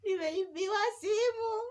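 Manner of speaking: high, loud crying-out imitation; mock wailing. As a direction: wail it out like a hurt little sister. Retold tearful answer to brother.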